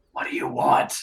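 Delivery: voiceless, under-the-breath challenge; low gruff-whispered question. Acted. Canton turns over his shoulder to you, whispered question.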